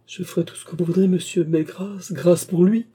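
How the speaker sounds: high, imploring voice; desperate pleading. A narrator's reading. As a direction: beg for mercy, rising to a cry. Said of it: "dont la voix expira à demi sur ses lèvres".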